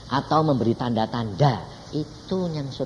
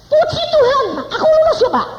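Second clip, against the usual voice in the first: a thrilled man, pitched way up and loud; excited high voice; 13 dB louder than usual. Retold or imitated. A relieved man rejoicing to the pastor, in a high excited voice. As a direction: squeal it out like an overjoyed believer. The man's relieved cry retold.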